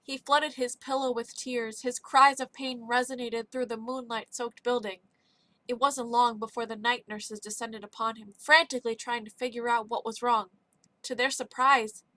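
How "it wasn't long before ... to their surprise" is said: ordinary delivery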